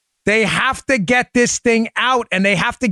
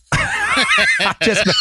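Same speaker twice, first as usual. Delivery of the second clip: laughing. Real, not acted. Host laughing at his own cocky joke to cohost, real laugh.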